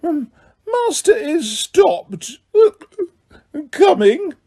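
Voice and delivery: high, slow, weepy elf voice; a drunken house elf on the edge of tears; Winky slurring through tears about her master; drunk tearful elf. A narrator's reading. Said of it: "She swayed slightly and then said".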